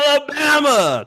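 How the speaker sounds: high, long-drawn holler; mock outrage. Real, not acted. Co-host outing a prank caller, mock-outraged holler. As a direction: holler it out, stretching the name.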